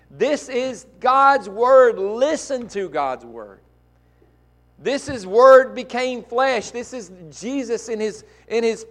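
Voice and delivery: very high, loud and slow, hammering each phrase; full-force preaching. Acted. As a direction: drive God's Word home to the church.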